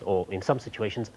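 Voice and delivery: usual speaking voice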